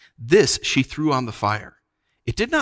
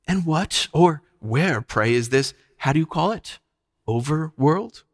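higher, lilting feigned puzzlement; mocking witch; 5 semitones higher than usual. A narrator's reading. Witch questioning Puddleglum about Overworld, sweetly mocking. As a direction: toy with an unfamiliar word.

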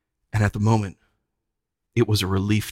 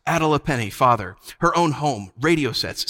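ordinary delivery

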